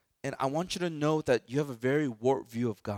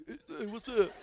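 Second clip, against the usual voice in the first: higher, laughing mock tough greeting; wannabe gangster; 8 semitones higher, slower than usual. Pastor mocks suburban youth acting tough, laughing mock swagger. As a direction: lightly put on a wannabe-cool swagger. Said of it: "try to be like gangbangers".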